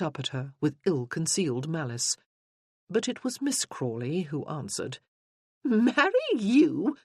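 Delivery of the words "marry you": scoffing, disbelieving rise on marry; mocking high scoff; scornful laugh. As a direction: laugh the question in her face. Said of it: "she said with a scornful laugh".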